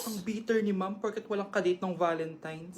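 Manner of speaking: low, soft snide aside; student whispering behind teacher's back; muttered aside. Acted. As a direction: say it under your breath so ma'am can't hear. Student mocks teacher under breath.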